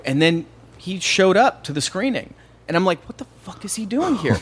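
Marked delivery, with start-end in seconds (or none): 3.1-4.4 high-pitched, stunned disbelief; baffled, pitch leaping up; retold shock. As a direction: blurt it like a startled inner thought. Retold shock at star showing up.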